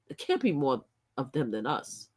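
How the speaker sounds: murmured disbelief, "can't" lightly lifted; quiet denial. Real, not acted. Vlogger recalling her first doubt, quietly.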